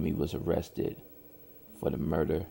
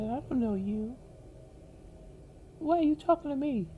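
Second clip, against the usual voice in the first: much higher, put-on female voice rising in indignation; a clearly voiced Trisha, higher and sharper; high woman's voice for Trisha. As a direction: play the offended young woman brushing him off. Trisha rejects Jimmy, quoted.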